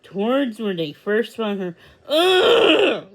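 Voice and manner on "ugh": loud high groan of frustration; frustrated ugh. Acted. Lizzie sees the puppy escape, loud frustrated ugh. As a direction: let out a loud fed-up ugh. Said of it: "Lizzie cried".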